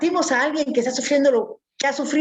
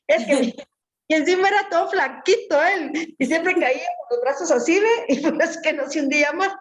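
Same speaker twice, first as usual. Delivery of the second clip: ordinary talk, nothing marked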